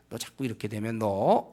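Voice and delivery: put-on low, slow and quiet warning to the child; a parent's slow, hushed warning; stern parental warning. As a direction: hold back and let the threat hang.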